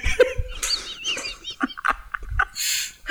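breathless squeal of laughter; high squealing laugh. Real, not acted. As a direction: laugh until the voice goes silent. Cracking up at own joke. (fou rire aigu et soufflé)

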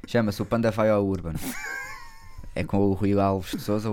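loud, proud podcast intro; show announcement. Real, not acted. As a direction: present the show title with a flourish. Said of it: "announcing podcast name". (anúncio do podcast em voz alta)

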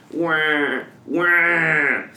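put-on high wailing child voice; crying demon child. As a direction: sob it out like a little demon. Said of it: "he's just gonna say".

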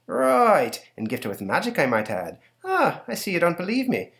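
a hint of a gleeful leprechaun. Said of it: "says the leprechaun".

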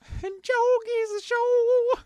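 high, slow show-intro announcer; mock podcast announcer. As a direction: boom it out like a radio host.

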